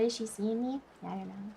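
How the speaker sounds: ordinary talk, nothing marked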